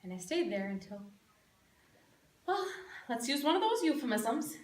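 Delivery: soft, low, with heavy pauses; acted grief, barely getting it out; restrained grief. Mother admitting her son passed on, quietly grieving. Mother tells of son's death.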